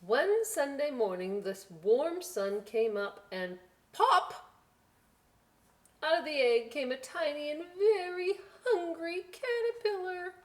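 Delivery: bright, high storybook voice, rising with excitement; reading to children. Acted. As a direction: build the wonder of the hatching for little listeners. Reads picture book aloud.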